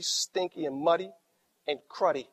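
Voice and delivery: ordinary talk, nothing marked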